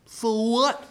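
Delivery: high, dismissive question; bratty retort. Acted. Cursed girl refusing to answer the door, high bratty retort. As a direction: push the pitch up insolently.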